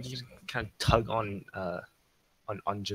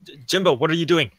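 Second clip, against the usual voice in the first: loud, raised in-character demand; alarmed shout; 4 semitones higher, 9 dB louder than usual. Acted. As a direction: call out sharply higher, tripping on the name. Player shouts at Jimbo in character.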